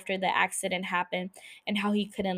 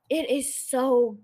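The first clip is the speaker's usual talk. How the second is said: gushing; 3 semitones higher, slower than usual. Real, not acted. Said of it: "I got all emotional".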